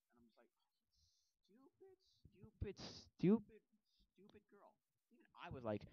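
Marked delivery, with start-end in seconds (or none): 1.5-4.7 high, mostly hushed scolding; exasperated muttering. Acted. Viewer scolding a stuck character, exasperated. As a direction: scold under your breath, pitched up.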